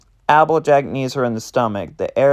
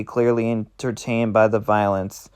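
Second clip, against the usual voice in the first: ordinary delivery, nothing marked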